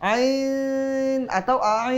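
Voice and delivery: very slow, "ain" held for four counts, then for six; two lengths of ain. Shown as a demonstration. Teacher contrasting four and six counts, slow and held. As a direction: stretch "ain" first shorter, then longer. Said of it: "bisa dibaca empat harokat, bisa dibaca enam".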